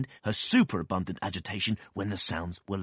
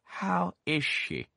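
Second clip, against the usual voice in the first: slow, high, stern question; stern aunt; 11 semitones higher, much slower than usual. A narrator's reading. Aunt demanding news from the doctor, stern and slow. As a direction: demand it slowly.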